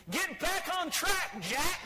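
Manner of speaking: high, slow mock yell; screaming GPS. GPS fed up with the driver, mock screaming. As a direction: scold the driver as a fed-up machine. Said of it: "It was screaming at us".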